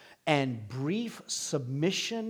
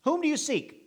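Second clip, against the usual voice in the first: higher, asking the question gently; a hint of Jesus asking, pitched up; 10 semitones higher than usual. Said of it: "Jesus says the exact same question".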